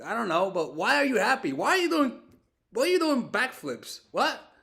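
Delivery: high, loud disbelief; incredulous. Retold or imitated. Boyfriend baffled she is happy, retold incredulity. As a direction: pitch climbing in baffled protest. Retelling his baffled reaction to her.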